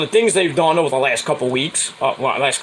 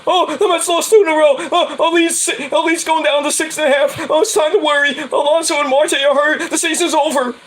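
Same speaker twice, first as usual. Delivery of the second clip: whiny wannabe fan; 8 semitones higher than usual. Retold or imitated. Vlogger mocking complaining fans, high whine. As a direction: ham up the doom-saying fan. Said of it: "wannabe Met fans that just complain".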